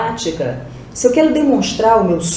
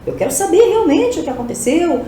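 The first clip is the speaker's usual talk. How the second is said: higher-pitched mock astonishment; demonstrated amazement, pitched up; astonished; 7 semitones higher than usual. Shown as a demonstration. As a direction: voice the shocked questioner. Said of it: "Vai entender que eu".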